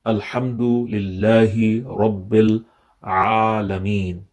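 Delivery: ordinary talk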